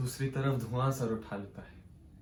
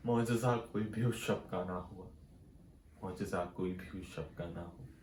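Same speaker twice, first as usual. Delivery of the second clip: soft refrain, sinking lower on the repeat; fading sombre refrain; 6 semitones lower, 9 dB quieter than usual. Acted. Poet closing the tale of those burned, fading lament. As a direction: let the repeated line die away.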